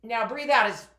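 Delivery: loud, pushing every bit of air out; forceful exhale. Shown as a demonstration. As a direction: breathe out hard and loud while speaking. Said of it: "breathing coach demo".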